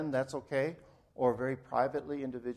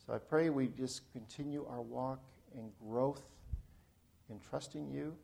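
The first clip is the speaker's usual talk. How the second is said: closing prayer; 3 semitones lower, 4 dB quieter than usual. Real, not acted. Pastor praying for his struggling congregation, subdued and reverent. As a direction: pray unhurriedly, voice settling low and soft at phrase ends.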